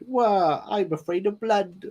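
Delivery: a hint of a nervous object; talking cot. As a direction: squeak like a scared little cot. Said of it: "the little cot going".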